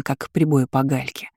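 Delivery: plain reading voice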